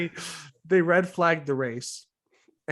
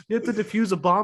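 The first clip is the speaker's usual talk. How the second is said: ordinary talk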